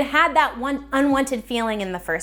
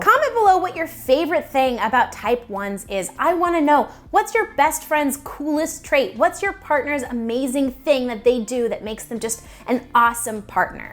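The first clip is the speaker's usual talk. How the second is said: usual speaking voice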